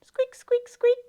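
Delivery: high little mouse squeaks. Acted. Storyteller plays Kevin the mouse for children, squeaking high. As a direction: squeak quickly like a tiny mouse. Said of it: "A mouse named Kevin".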